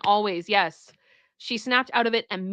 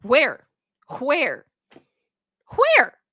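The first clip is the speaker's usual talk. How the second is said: building indignation; 4 semitones higher, 4 dB louder, much slower than usual. Real, not acted. Mock search building.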